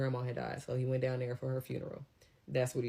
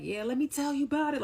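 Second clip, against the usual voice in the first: hyped coworker; 11 semitones higher than usual. Retold or imitated. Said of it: "he got hype".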